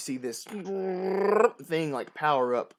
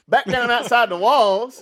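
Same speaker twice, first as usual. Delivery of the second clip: put-on southern accent; 8 semitones higher, 13 dB louder than usual. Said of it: "making fun of it ... in a southern accent".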